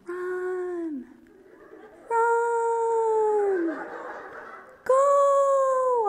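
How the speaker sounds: high far-off calls of run; distant shouting. Retold or imitated. Teammates calling from far away, high distant yells. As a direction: sound like shouting from across the field. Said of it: "this distant noise".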